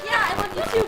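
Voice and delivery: high, loud, amused retelling; excited, laughing imitation of a reply; laughing quote. Same reply retold through laughter.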